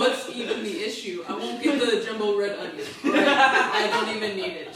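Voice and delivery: a fed-up shopper giving up on the onion; exasperated customer. Acted. Exasperated customer, louder, laughing.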